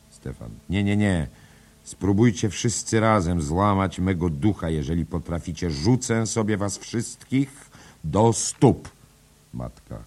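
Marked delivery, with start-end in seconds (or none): 0.7-8.9 defiant outcry. A narrator's reading. Stefan defies the ghost mother.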